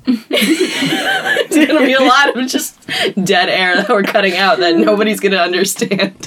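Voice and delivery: ordinary talk, nothing marked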